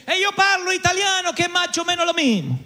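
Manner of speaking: high, light Italian lady's voice. Retold or imitated. An Italian lady answers him in a high voice. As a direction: play the helpful Italian passenger. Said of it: "se para una señora y dice".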